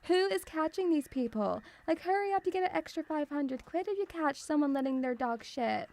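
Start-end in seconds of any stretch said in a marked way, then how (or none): none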